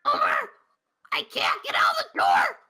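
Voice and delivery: strained Marge calling for Homer; Marge Simpson voice. Acted.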